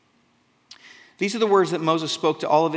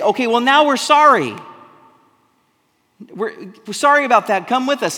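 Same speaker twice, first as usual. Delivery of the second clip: higher and much louder, a hammed-up plea; pleading apology; 5 semitones higher, 8 dB louder than usual. Israelites begging the Lord to come along, pleading loudly. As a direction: beg forgiveness in a rush, overplayed. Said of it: "they say".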